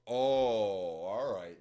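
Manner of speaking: loud, long drawn-out "oh"; surprised realization. Real, not acted. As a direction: stretch the reaction out big. Surprised by news of a child.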